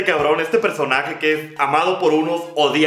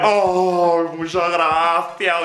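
slow, put-on old lady thanks; much slower than usual. Doña thanking the young man, slow and put-on. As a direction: drawl it like a tired doña. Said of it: "La doña".

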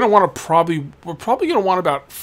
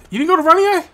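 much higher, loud disbelief; surprised; 14 semitones higher, 6 dB louder, faster than usual. Acted. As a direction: act amazed they skipped it. Surprised question to customer.

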